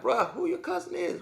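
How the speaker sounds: put-on high, hushed disbelief from a kid who just got beaten; a kid squeaking out who's your cousin; stunned high voice.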